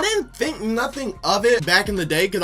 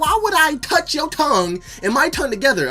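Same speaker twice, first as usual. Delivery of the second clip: outraged disgust; 9 semitones higher, 4 dB louder than usual. Retold or imitated. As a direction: rant at her, disgusted and shrill-high. Retold disgust at French kiss.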